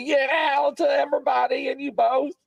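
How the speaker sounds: high-pitched rallying shout; exuberant call-out. Real, not acted. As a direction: holler the greeting high and long. Calling back a holiday greeting.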